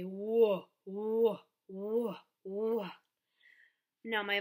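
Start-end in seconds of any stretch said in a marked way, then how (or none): 0.0-2.9 falling Whoa swoops like a ride; playful Whoa, whoa cries for the W sound; roller coaster whoas. Shown as a demonstration. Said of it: "put 'em in a roller coaster and say".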